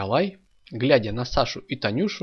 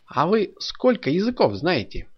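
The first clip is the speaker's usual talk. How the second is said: higher, needling question; venomous; 8 semitones higher, 4 dB louder than usual. A narrator's reading. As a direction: ask it with a venomous edge. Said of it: "ядовито спросил Молчун".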